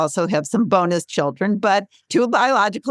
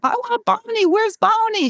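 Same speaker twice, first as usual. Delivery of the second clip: put-on much higher whiny toddler voice, R's dropped; little daughter's lisp. Mother imitating her daughter begging for Barney, high and whiny. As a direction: whine it like a small girl who wants Barney. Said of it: "couldn't say her R's".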